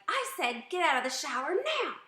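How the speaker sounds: loud, high-pitched parental yell; angry yell. Shown as a demonstration. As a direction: yell it at the kids, fed up. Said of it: "Notice my tone of voice".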